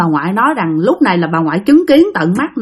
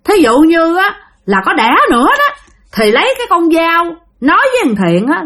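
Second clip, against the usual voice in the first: high-pitched ghost giving orders; cô Liễu; 5 semitones higher than usual. Acted. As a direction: lay down the terms in a high, ringing voice. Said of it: "cổ mới nói rằng".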